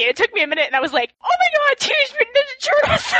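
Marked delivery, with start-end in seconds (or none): 1.2-3.1 excited realization. Retold or imitated. Sudden realization retold to cohosts.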